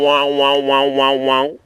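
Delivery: mimicking a garbled phone going "wow-wow"; droning, pulsing phone-distortion noise; imitated phone warble. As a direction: repeat wah-wah noises like a breaking-up line. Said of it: "'cause the phone's going".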